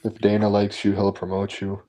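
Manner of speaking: ordinary talk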